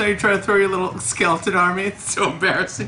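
high, loud jeering at the enemy; loud mocking. Real, not acted. Player jeering at opponent's skeleton army, loud and high. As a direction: laugh them off the board.

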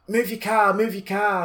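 put-on high Irish old lady scolding, pitched well up. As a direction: nag like an angry little Irish granny. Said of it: "she's like".

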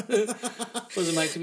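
laughing through the words, slow. Real, not acted. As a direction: chuckle it out, amused. Repeats line laughing.

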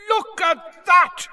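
high, loud excited yell. A narrator's reading. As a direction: shout it across the stadium. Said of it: "Bagman was yelling".

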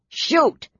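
high barked command; sharp order. Acted. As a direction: bark the order. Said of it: "Derek ordered".

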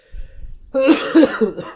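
loud hacking cough; harsh chesty coughing; coughing fit. Real, not acted. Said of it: "I'm coughing so much".

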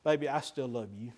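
soft, low loving murmur; tender. Pretend. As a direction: say it gently to your wife after a fight. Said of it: "he just".

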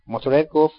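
plain reading voice

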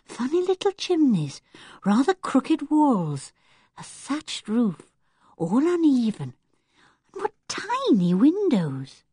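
put-on a young girl marvelling at a cottage; Anne's voice. Girl admiring the little cottage, delighted.

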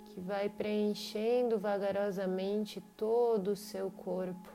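normal talking voice

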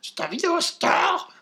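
high, slow squeaky cartoon voice; drunk chipmunk voice. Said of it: "comme un [name] bourré".

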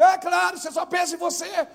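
sudden high, loud retort; exasperated retold outburst. Pastor scolding the self-centred member, exasperated outburst. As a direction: burst out high and loud in reproach.

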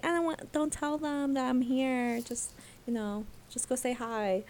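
softly acted plea to keep a secret; hushed secrecy. [name] asking his friend to hide him, hushed.

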